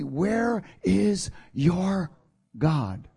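enemy's mock taunt. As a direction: drawl it like a taunting voice. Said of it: "they continue to say to me".

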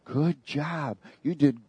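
soft, sweet, coaxing praise; gentle tempting voice. Retold or imitated. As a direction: purr the compliments like a tempter. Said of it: "the first words out of his mouth was real gentle".

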